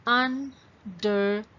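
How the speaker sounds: "Understand" slowly, three separate beats; broken into syllables. Shown as a demonstration. Teacher repeats for students to count, "Understand" split into syllables. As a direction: clear pause after each syllable of "Understand". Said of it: "So how many syllables in it?".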